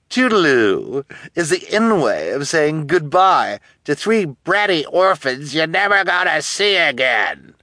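high, loud, theatrical taunt; mocking villainess. A narrator's reading. Guardian bidding bratty orphans goodbye forever, mocking. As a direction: rub in the farewell with relish.

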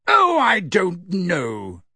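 booming voice. Acted. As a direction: boom it out slowly. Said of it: "in her booming voice".

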